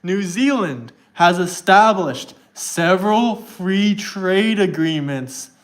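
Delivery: slow, deliberate word-by-word reading; extra slow reading. Shown as a demonstration. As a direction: spell it out slowly for someone not keeping up. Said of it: "I'll read it extra slow for you".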